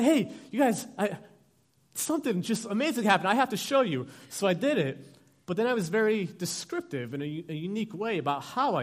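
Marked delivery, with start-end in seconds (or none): 0.0-4.1 put-on higher, louder voice of an eager seven-year-old; excited kid. Kid rushing to show his parents a wrestling move, excited. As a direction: burst out with thrilling news.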